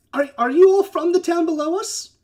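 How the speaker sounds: high, curious giant voice; jovial giant. Acted. Cloud giant questioning the little visitors, high and jovial. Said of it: "a little higher pitched than you expected".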